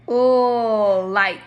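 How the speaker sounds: "Ooh" held out loud, then "like" spoken; teacher isolates the first sound. Shown as a demonstration. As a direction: stretch the "Ooh" loudly before "like". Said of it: "Can everyone make that sound".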